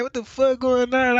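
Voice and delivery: high, loud confused outburst; groggy alarm. Retold or imitated. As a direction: woken up and freaked out.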